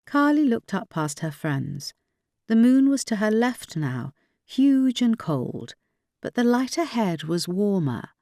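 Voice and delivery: ordinary delivery, nothing marked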